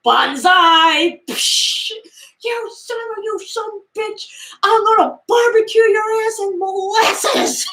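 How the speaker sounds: high put-on voice, laughing through it; hammed-up angry sheriff; sheriff's rant.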